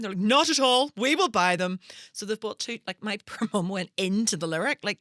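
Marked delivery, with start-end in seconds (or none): 0.2-1.8 firm insistence. Retold or imitated. Parents insisting.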